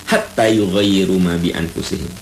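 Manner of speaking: usual speaking voice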